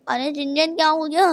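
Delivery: normal talking voice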